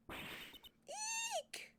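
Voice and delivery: a sudden high squeak of fright; startled squeak. Acted. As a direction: squeal as if caught off guard. Startled by the strange man.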